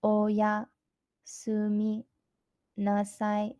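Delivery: slow "Oyasumi nasai" in separated chunks; slow demonstration. Shown as a demonstration. Teacher showing learners how to say goodnight, "Oyasumi nasai" slowly broken up. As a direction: say "Oyasumi nasai" slowly, with long pauses between parts. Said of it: "let me say that slowly".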